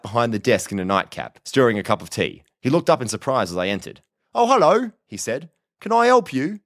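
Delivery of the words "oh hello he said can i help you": high-pitched, louder, politely surprised officer; surprised village policeman. A narrator's reading. Night-shift officer greets an unexpected visitor, surprised. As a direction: greet a late caller in a high, startled voice.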